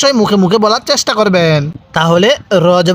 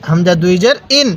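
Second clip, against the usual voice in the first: normal talking voice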